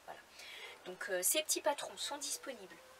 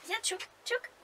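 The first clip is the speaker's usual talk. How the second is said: talking to a dog; 5 dB louder than usual. Real, not acted. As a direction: coax the dog over sweetly. Coaxing the dog to come.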